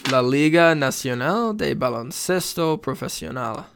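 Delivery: game-show host carefully pronouncing Spanish; Spanish accent. Real, not acted. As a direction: roll out the league's name in Spanish. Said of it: "a little bit of a Alex Trebek in you".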